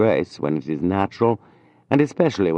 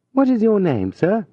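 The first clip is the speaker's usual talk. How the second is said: higher, gracious lady asking a name; graceful lady; 3 dB louder than usual. A narrator's reading. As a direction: ask with light charm, pitched up. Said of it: "in her graceful accent".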